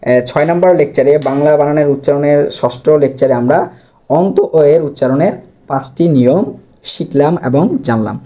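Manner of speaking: normal talking voice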